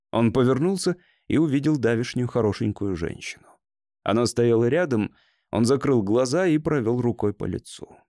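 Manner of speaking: usual speaking voice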